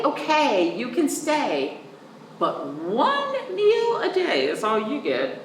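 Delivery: usual speaking voice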